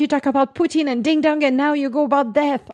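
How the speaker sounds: mock shocked listener. Imagining listeners' horrified reaction to her talk of death. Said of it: "It's like".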